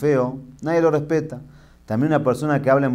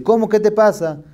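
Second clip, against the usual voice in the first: angry driver; 9 semitones higher, 4 dB louder than usual. Retold or imitated. Bus driver confronts passenger.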